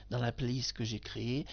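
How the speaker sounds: ordinary talk, nothing marked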